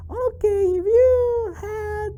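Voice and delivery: very high squeaky puppet voice; piping little character voice; Charlie. Acted. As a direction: recite the answer as a squeaky puppet. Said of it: "Very good, Charlie".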